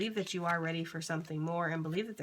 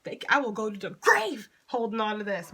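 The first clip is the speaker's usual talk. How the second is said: mock defiant outburst; 2 semitones higher, 7 dB louder than usual. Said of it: "For some of you that's like".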